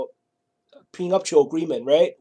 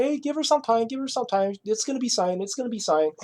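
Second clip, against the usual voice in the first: higher, soft reassuring mother's voice; calm him down gently, like a mother buying time; soothing mother; 5 semitones higher, 5 dB quieter than usual. Retold or imitated. Mother calming him about prenuptial.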